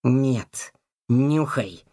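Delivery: low, firm insistence; insistent. A narrator's reading. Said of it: "стоял на своём Котовский". (настойчиво, низким голосом)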